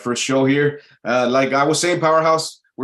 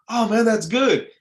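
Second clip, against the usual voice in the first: mock distracted chipper reply, pitched high; fake cheerful reply; 10 semitones higher than usual. Imitating a stranger who cheerily answers without listening. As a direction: toss it off brightly without really listening. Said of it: "you could tell they're not listening".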